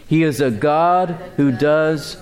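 slow, weighty, said together; slow congregational recital. Shown as a demonstration. Pastor leading the congregation in a slow recital. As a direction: lead the church in saying it slowly. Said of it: "Say that with me".

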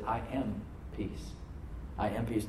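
quiet reassuring repeat; hushed God. Acted. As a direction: soften to a near hush on the promise. Tender repetition of God's words.

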